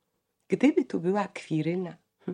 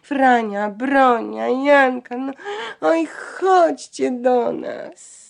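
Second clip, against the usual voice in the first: moaning call; 8 semitones higher, 8 dB louder, much slower than usual. A narrator's reading. Pale sisters calling down from the balcony, high drawn-out moaning. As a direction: wail their names from the balcony, stretching each one. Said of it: "i jęczały".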